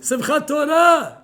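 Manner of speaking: high, loud and drawn out, scandalized; the father calls out the holiday in protest; father's sharp objection. Retold or imitated. Father protests his daughter's plan, loud and slow.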